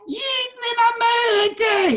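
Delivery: put-on high, loud, slow wailing cry of an old rebbe; imitating the Minchas Elazar's cry. Rabbi reenacting the rebbe's wedding speech, high wailing shout. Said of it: "That's the way he said it".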